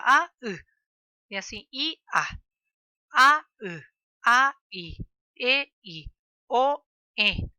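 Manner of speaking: A, U and A, I called loud, each vowel separated by a glottal break; vowel pairs spelled out. Shown as a demonstration. Teacher naming the double vowels for pupils, loud with stops. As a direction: chant each vowel pair with a stop between. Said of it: "doble vocales acá".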